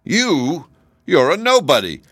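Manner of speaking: high, loud scorn; shouting. A narrator's reading. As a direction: shout him down, pitched high. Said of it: "shouted Captain Fighter".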